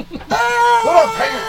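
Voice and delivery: very high, slow giggly squeal; laughing squeal. Retold or imitated.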